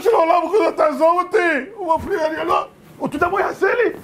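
high, tearful shouting plea; crying frightened plea. Acted. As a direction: beg for help in panic. Messenger pleads, terrified of rabbi.

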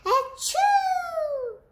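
loud, high acted sneeze; big sneeze. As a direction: sneeze it out with a burst. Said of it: "and sneezed".

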